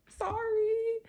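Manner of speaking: very high, small "sorry"; embarrassed apology. Retold or imitated. Driver showing neighbour his Jeep, embarrassed high squeak. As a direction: squeak out a sheepish mock apology. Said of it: "so embarrassed".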